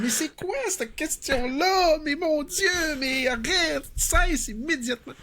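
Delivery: high, slow, shocked and exasperated. Retold or imitated. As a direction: play it way up, outraged. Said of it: "Je suis comme".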